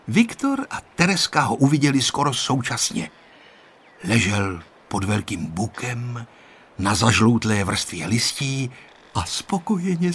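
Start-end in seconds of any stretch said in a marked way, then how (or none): none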